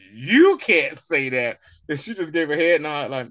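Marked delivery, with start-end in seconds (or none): 0.1-1.6 very slow, louder, each word laid down; slow emphatic rebuke. Retold or imitated. Fury correcting her, very slow emphatic rebuke. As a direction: drag it out like a final warning. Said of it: "He was like".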